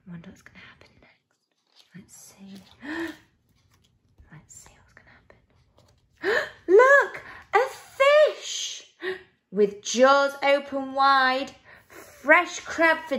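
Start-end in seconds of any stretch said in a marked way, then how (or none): 0.1-2.6 whispered, quick aside; suspenseful whisper. A narrator's reading. Reader to children before the page turns, whispering. 2.8-6.7 suspenseful whisper. A narrator's reading. Reader to children wondering what will happen, whispering. 6.7-8.8 very high, loud storybook alarm; alarmed exclamation. A narrator's reading. Narrator warning children of the fish, alarmed.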